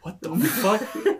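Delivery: slow laughing disbelief. Retold or imitated. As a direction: laugh out the question. Laughing at meme reaction.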